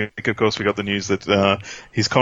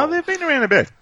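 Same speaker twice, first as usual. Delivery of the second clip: voice cracking up an octave; faster than usual. Real, not acted. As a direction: voice breaks high mid-sentence. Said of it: "my voice just broke".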